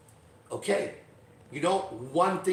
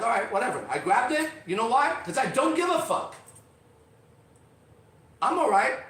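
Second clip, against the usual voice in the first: defiant bravado; 8 semitones higher, 5 dB louder than usual. Real, not acted. Defiant money story, raised voice.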